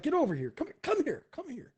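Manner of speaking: high-pitched, urgent beckoning; insistent come-hither call; summoning underlings. Acted. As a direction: call them over, voice jumping up then dropping away. Kung Po summons mercenaries.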